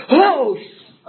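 sudden high, loud cut-off curse; shocked gasp. Retold or imitated. Sponsor hearing his sponsee admits craving a drink, shocked outburst. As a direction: blurt it out, caught off guard. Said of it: "And I went".

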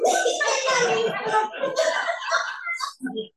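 high whooping shout of laughter. Real, not acted. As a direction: burst into laughter. Laughing at own realisation.

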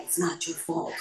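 low, hushed and deliberate reassurance; Good Will Hunting sponsor. Retold or imitated. Sponsor to her after the fifth step, low gentle reassurance. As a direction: imitate a sponsor softly absolving someone. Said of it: "it was like Good Will Hunting".

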